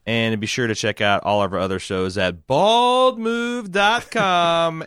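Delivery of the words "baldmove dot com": hammed-up, stretched-out web address, pitched high; drawn-out plug. Podcast host plugging other shows at sign-off, hammed-up and drawn out. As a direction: hold each word long and high, playfully.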